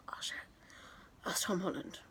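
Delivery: hushed, breathless oh shit; low hushed recognition; shocked whisper. Retold or imitated. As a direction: whisper it so nobody hears. Retold whispered realisation.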